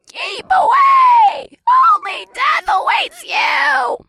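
loud, high, drawn-out warning wail; terrifying spirit crying out; banshee wail. A narrator's reading. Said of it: "The voices wailed like a group of banshees".

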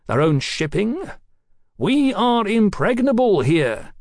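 high, ringing, triumphant boast; boastful declaiming. Acted. Captain boasts of his safe headquarters, declaiming. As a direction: proclaim your stronghold unbeatable.

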